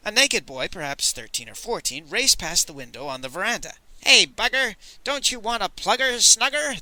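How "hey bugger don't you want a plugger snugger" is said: high, loud teasing shout; happy yell. Acted. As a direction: yell playfully across the veranda. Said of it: "the happy voice yelled".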